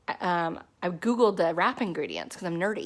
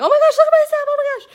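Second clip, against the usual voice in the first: much higher, louder excited gush; thrilled squeal; 11 dB louder, much faster than usual. Retold or imitated. Showing off her wrap results, much higher and louder thrilled squeal. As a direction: squeal over the results like a delighted kid. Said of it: "I'm like".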